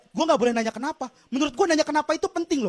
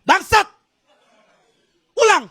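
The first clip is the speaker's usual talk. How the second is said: shout; 5 semitones higher, 4 dB louder, slower than usual. Retold or imitated. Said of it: "gue teriakin".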